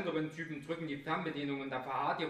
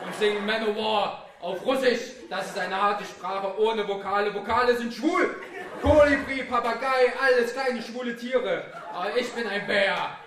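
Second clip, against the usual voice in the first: loud and high, laughing through the boasts; laughing macho rant; 5 semitones higher, 10 dB louder than usual. Acted. Sneering at vowels and small birds in a laughing loud rant. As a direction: rant like a beer-hall brute, cracking up.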